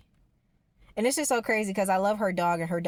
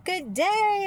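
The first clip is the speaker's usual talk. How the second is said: high bright sing-song good day; mock cheery greeting; 11 semitones higher, 4 dB louder than usual. Imitating the neighbor's usual cheery hello. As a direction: chirp it like her usual cheerful self. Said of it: "Usually she's all like".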